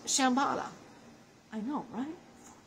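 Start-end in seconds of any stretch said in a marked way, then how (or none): none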